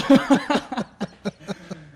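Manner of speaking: loud laughing fit; laughter. Real, not acted. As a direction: laugh hard. Both laughing.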